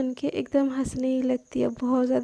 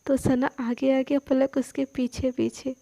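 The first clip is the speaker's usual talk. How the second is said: plain reading voice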